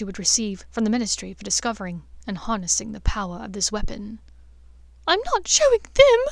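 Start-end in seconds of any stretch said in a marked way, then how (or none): 5.1-6.2 high, loud tearful cry; sobbing outburst. A narrator's reading. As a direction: blurt it out through sobs, much higher. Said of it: "Hermione sobbed".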